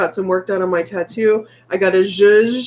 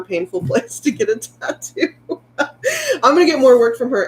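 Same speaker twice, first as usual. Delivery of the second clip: laughing; 8 semitones higher than usual. Real, not acted. Laughs mid-sentence.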